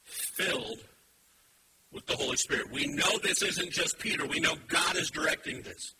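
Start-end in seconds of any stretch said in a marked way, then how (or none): none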